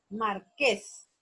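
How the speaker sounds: "marqués" split into mar... QUÉS; syllables, stress on QUÉS. Shown as a demonstration. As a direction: sound out "marqués" in two beats, ending on a held QUÉS. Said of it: "la sílaba tónica es ques".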